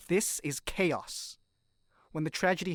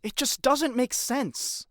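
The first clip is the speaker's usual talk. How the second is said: higher, louder and slow, voice straining; anguished disbelief; 8 semitones higher, 5 dB louder, slower than usual. Acted. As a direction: plead it out in pained disbelief. Distressed argument about Comet.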